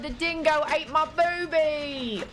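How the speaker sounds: mock Aussie accent. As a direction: put on a slow, exaggerated Aussie accent. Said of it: "trying to do a London accent. As well as an Aussie".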